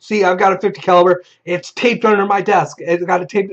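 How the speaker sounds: high-pitched, mock-desperate arguing; acted insistence. Player showing the storyteller his sheet, acted insistence. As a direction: plead the case as the player.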